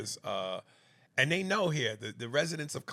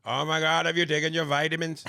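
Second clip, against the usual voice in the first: louder mock British accent; "vitamins" as VIT-a-mins, hammed-up British accent; terrible British accent; 4 semitones higher, 7 dB louder than usual. A loud, awful British accent. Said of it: "that is the worst accent".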